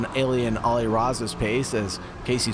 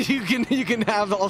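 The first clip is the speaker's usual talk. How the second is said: laughing; 11 semitones higher than usual. Real, not acted. Laughing at co-host's joke.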